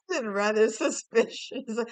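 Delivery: ordinary delivery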